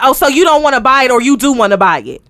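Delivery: imitating a loud, insistent realtor; too loud caller. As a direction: press the question loudly. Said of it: "bring it on down".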